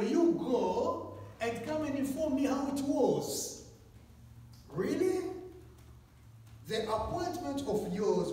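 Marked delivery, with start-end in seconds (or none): 0.0-3.6 higher, slower, put-on lazy request; lazy churchgoer. 4.7-5.3 much higher, disbelieving "Really?"; incredulous. Real, not acted. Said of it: "preacher's incredulous reaction".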